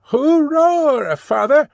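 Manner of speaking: put-on high, loud boyish hoorah; a boy cheering with glee; young Jerry cheering to his father, high and loud; excited cry. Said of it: "cried young Jerry".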